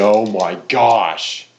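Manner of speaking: exasperated. Real, not acted. Game setback, frustrated outburst.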